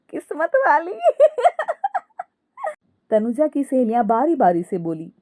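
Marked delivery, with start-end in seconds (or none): 0.0-1.2 very high cackles round higher echoed words; continuous laughing. Acted. Daughter-in-law echoing the friends' compliment, laughing nonstop. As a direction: repeat the compliment between helpless laughs. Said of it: "Ankita हंसती ही रहती है और बोलती है".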